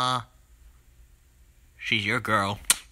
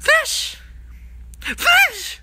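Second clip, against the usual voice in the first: high, furious screamed accusation; hammed-up anguished shout; betrayed screaming; 9 semitones higher, much slower than usual. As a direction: scream it out, stretching the last word into a wail. Betrayed Yoshi's climactic accusation.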